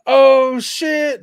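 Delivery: high, drawn-out mock holler; excited recognition. Acting out surprise meeting in elevator.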